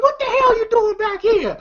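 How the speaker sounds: high put-on neighbor voice; mock neighbor. Neighbor questioning Smokey, high put-on voice. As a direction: squeak it like a nosy neighbor.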